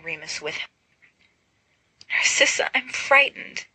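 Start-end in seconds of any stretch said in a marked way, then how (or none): 2.1-3.7 high, small frightened whimper. Acted. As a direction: whimper it, clinging close. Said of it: "Carmina whimpers".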